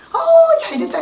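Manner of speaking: very high, bright cry of joy; delighted squeal. Retold or imitated. Neighbour rejoicing over the supposed loan, squealing high. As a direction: squeal with happiness.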